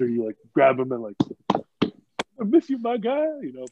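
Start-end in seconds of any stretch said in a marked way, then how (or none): 1.5-3.2 slow, high affectionate goodbye; fond farewell. Retold or imitated. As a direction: stretch it out like a hug. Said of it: "the grown man hugs".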